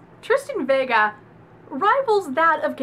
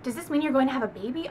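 ordinary delivery

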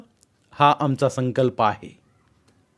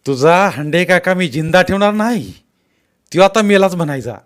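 put-on higher, louder furious threat shouted at the girl; angry threat; 6 semitones higher, 6 dB louder than usual. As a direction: bellow that you'll kill him. Uncle threatens Manji's teacher.

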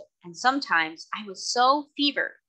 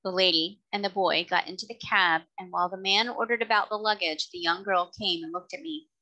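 usual speaking voice

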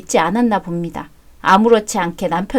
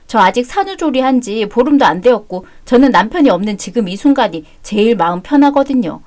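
usual speaking voice

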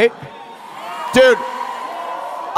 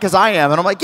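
usual speaking voice

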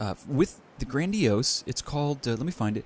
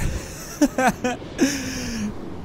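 laugh; 9 semitones higher than usual. Real, not acted. Laughing at enemy's speed.

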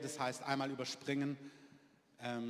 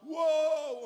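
loud, high mock tongues with a long wailed wow; imitated praying in tongues; 8 semitones higher, 7 dB louder, slower than usual. Preacher showing groaning in the Spirit, loud imitated tongues. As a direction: burst into imitated ecstatic prayer. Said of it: "unaussprechliche Worte sagen".

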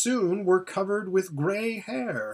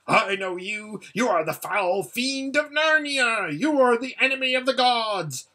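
higher, frantic accusing rant; raging; 8 semitones higher, 4 dB louder than usual. Acted. Rabadash raging at Aslan, high and frantic. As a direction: hurl the accusation at the lion.

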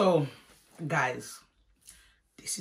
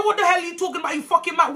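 high-pitched incredulous rant; acted angry outburst; 9 semitones higher, faster than usual. Esther snaps at auntie in front of mom, angry high outburst. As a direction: lash out, pitch jumping up.

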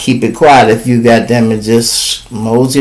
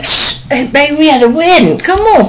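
put-on hushing boyfriend; 12 semitones higher than usual. Man hushing his partner.

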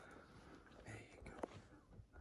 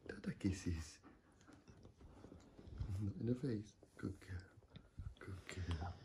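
much softer soft whisper; whispered praise; 6 semitones lower, 10 dB quieter than usual. Real, not acted. Hushed praise to the baby.

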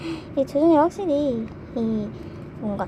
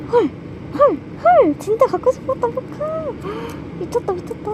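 higher and louder, squealing with joy; thrilled squeal; 10 semitones higher, 4 dB louder than usual. Real, not acted. Fan finds the photocard she wanted, louder high squeal. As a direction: shriek at finally getting it.

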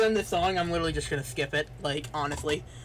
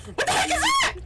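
startled shout; 5 dB louder than usual. Real, not acted. Sudden shock at game screen.

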